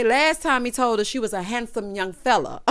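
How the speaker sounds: ordinary talk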